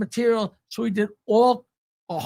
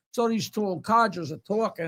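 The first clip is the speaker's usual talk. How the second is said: usual speaking voice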